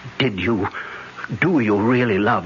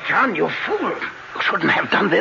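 higher, urgent rebuke; alarmed scolding; 10 semitones higher, faster than usual. Acted. Professor to John who exposed the count, alarmed scolding. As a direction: hit him with alarmed dismay.

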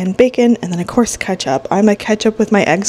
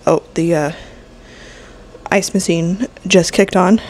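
ordinary talk, nothing marked

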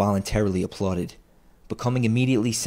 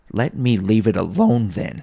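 louder start, dropping on then; gruff retort; 2 semitones higher, 6 dB louder than usual. A narrator's reading. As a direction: dismiss the nephew crossly. Scrooge curtly ends the argument.

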